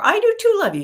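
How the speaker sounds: high, loud mock protest; argumentative retort. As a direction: shoot back in a hammed-up squabble. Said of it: "No argument, right?".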